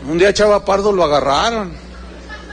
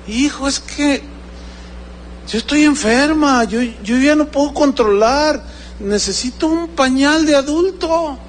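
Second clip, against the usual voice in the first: high, plaintive excuse; mock-pitiful high voice; pleading old mother, imitated; 6 semitones higher than usual. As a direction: lightly act an ailing mother explaining herself. Elderly mother pleads with child.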